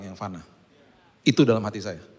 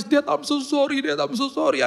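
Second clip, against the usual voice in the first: put-on much higher child voice, half crying; tearful little boy; slower than usual. Son apologizing to his dad, higher half-crying child voice. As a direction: plead like a sorry kid on the verge of tears. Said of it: "Terus dia setengah nangis".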